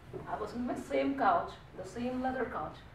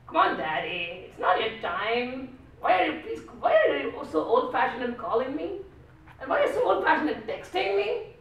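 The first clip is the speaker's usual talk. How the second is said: put-on louder, slow, teasing young woman; exasperated daughter; 5 semitones higher, 10 dB louder than usual. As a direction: scold Dad for being old-fashioned. Daughter scolding her father.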